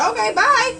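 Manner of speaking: high, loud, dismissive goodbye with laugh; brushing off the cursing catcaller, sassy and high; mock sassy bye. Dismissive retort, then laughing.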